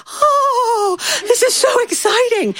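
squealing very high, breathy with excitement; gushing girls. Retold or imitated. Friends squealing over Rick Armstrong's library book, very high and thrilled. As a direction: pitch way up, gasping like thrilled schoolgirls. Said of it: "And they're like".

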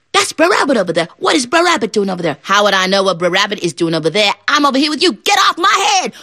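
high, fast frog bickering; high squabbling frogs. Acted.

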